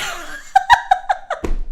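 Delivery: bright high burst of laughter; real high-pitched laughter. Real, not acted. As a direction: let a high laugh spill out. Laughs at own joke.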